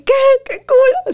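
put-on thrilled girl shrieking at the comedy show; excited like a child. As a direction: squeal like the most excited little girl. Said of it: "Así como la niña más emocionada del mundo".

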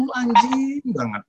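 "anjing" drawn out high and gently; sweetly lengthened high insult; smooth, polite swearing. Retold or imitated. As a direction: stretch "anjing" slowly, as if it were kind. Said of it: "Halus kan?".

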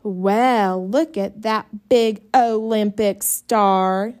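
slow mocking drawl; mock him slowly; sarcastic. A narrator's reading. Older sister mocking Jess, sarcastic and slow. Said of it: "said Ellie".

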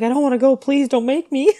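high, loud acted plea; mock pleading. Girl begging her mother not to send her to a party, high pleading.

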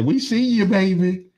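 high, sweet quoted voice; high pitched quote. Retold or imitated. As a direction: squeak it out sweetly. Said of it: "She say".

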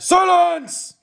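very high, loud shout; thundered order. A narrator's reading. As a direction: silence the room. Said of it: "thundered the policeman".

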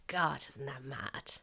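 whisper. Retold or imitated. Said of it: "and you're like".

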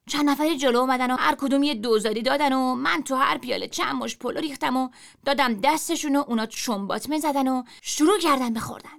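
ordinary delivery

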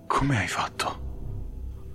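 slow, breathless whisper in awe. Acted. The paladin asks Torren how he healed her, slow awed whisper. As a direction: barely breathe the question, stunned. Stunned question after the healing.

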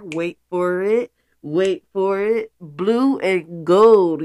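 building to a loud slow reveal; suspense build reveal. Real, not acted. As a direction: tease it then belt out the answer.

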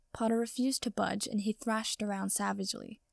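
plain reading voice

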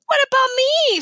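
put-on high, loud kid's plea; left-out little boy. A narrator's reading. Little brother wanting a friend too, pleading protest. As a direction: pipe up loudly, demanding to be included.

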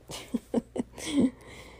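bright burst of laughter; laughing. Real, not acted. Laugh after yay.

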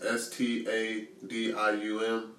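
S T A D I U M spelled slowly, letter by letter; spelling it out. Shown as a demonstration. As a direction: spell S T A D I U M one letter at a time. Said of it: "How do you spell that?".

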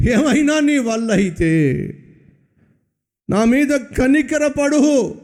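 slow, high, drawn-out imitated plea; pleading father. Father begging Jesus for mercy, high and slow. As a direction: cry out for mercy, stretching each word. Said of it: "అని ప్రార్థన చేశాడండి".

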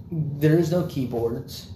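normal talking voice

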